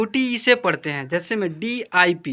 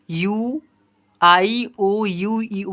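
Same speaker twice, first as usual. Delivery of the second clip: usual speaking voice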